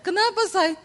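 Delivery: imitated startled concern; alarmed question. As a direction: ask with mild acted alarm what is wrong. Wife startled by husband's scream.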